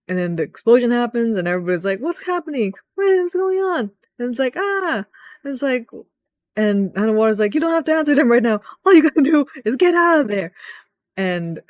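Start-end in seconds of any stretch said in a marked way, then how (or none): 2.0-3.9 much higher alarmed cries; high mock panic. Voicing panicked soldiers. 4.6-5.1 high alarmed yelp; high mock yelp; mock startled yelp. Voicing a soldier's alarm. 7.5-10.5 much higher, fast rushed warning; high rapid urgent voice; urgent ally. Pretend.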